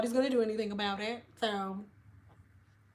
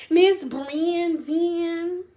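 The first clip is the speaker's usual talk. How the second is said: very slow, higher-pitched stretched name; playfully drawn-out name; 5 semitones higher, much slower than usual. Shown as a demonstration. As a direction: stretch "Brandon" as long as it will go. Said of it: "saying your last name like that".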